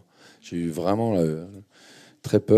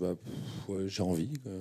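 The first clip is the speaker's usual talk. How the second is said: softer, half-whispered shrug of an answer; shy mumble; 10 dB quieter than usual. Retold or imitated. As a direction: answer sheepishly, almost under your breath. Shy answer to casting director. (réponse timide, presque chuchotée)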